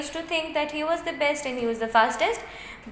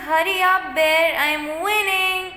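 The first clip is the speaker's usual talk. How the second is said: gleeful race taunt; 5 semitones higher, 4 dB louder than usual. A narrator's reading. Turtle two calls to the bear mid-race, taunting gleefully. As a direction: crow it out to the trailing rival.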